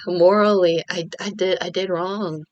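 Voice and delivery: ordinary delivery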